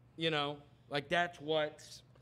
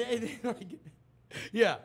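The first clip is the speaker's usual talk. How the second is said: ordinary delivery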